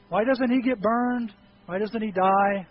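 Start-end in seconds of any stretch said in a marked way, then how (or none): none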